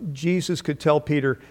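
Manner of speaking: ordinary talk, nothing marked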